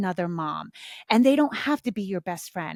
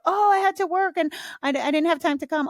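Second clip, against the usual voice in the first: high, light apologetic voice; sweet, apologetic answer; high apologetic reply; 7 semitones higher than usual. Retold or imitated.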